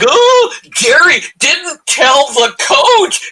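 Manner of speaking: high mock wail, half crying; mocking whiny fans. Mocking fans wailing about the coach, high and whiny. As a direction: whine it like a sobbing fan. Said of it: "everyone's like".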